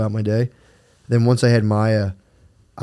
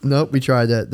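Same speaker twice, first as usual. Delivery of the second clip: normal talking voice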